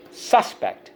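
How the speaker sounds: SUS-pect, first syllable punched high; stress on first syllable. Shown as a demonstration. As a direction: hit the SUS of "Suspect" and drop on pect. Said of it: "On the first syllable".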